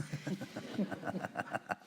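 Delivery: breathy unvoiced chuckle at the joke; a quiet laugh slipping out mid-poem; real laugh. Real, not acted. Reader amused by the poem's joke, laughing softly.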